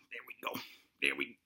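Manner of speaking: breathy under-the-breath there we go; strained whisper. Real, not acted. Host straining to flex, whispering to himself. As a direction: mutter it while straining.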